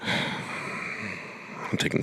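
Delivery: very quiet, slow, defeated pick; reluctant admission. Real, not acted. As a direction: give in under your breath, dragging it out. Reluctantly gives the dreaded pick.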